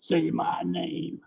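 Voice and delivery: very slow, soft menace; slow hushed threat. Pretend. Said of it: "can you give us one of those".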